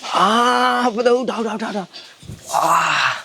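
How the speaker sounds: put-on old man straining to get up; groaning effort. Old man heaving himself up, groaning with effort. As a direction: groan through the words.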